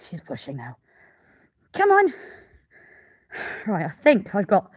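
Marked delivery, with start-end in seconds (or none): 1.7-2.1 a high encouraging call during the birth; high coaxing call. Real, not acted. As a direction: cheer the ewe on. Urging the ewe to push.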